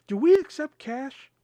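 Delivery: high, drawn-out mock-clueless question; feigned cluelessness, voice lifted; asinine question. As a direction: play dumb, pitched high and slow. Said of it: "the most asinine fucking questions".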